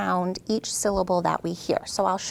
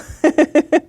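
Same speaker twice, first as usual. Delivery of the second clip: real laugh; 7 semitones higher, 11 dB louder than usual. Real, not acted. Child tries, teacher laughs.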